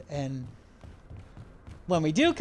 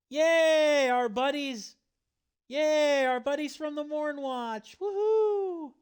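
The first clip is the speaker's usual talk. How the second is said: excited cheering; 8 semitones higher, slower than usual. Real, not acted. Streamer cheers at returning allies.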